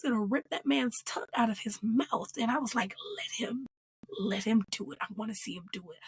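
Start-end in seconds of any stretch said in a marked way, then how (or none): 3.2-6.0 soft, half-whispered egging-on; hushed urging. Retold or imitated. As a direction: drop low and quiet, relishing the prospect. Urging on a threatened violent act.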